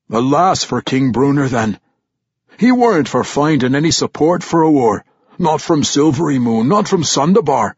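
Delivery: loud, high gruff dwarf scoff; gruff old dwarf warrior. Acted. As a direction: scoff like a crusty old veteran. Old warrior Ragged Dane voiced.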